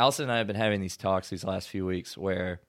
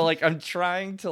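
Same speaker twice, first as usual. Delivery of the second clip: usual speaking voice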